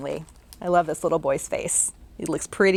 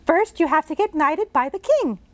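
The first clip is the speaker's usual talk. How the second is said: put-on much higher girl's voice; bright little girl; 7 semitones higher than usual. Jane greeting the ghost she can see, as a bright girl. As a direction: chirp it like a curious girl.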